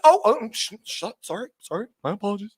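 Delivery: put-on high, then hushed, fumbling apology to the kids; a driving parent caught off guard by swearing, scrambling to say sorry; flustered parent apologizing. Parent driving with kids, apologizing for the swearing, flustered and hushed. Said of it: "been like".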